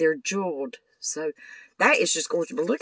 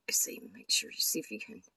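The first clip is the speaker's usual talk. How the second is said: fast whisper to herself; fast under-the-breath whisper; 8 semitones lower, 10 dB quieter, faster than usual. Real, not acted. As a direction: whisper it while fiddling. Quietly adjusting camera.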